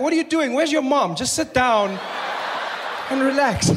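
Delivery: scolding parent. Retold or imitated. Scolding bleeding player.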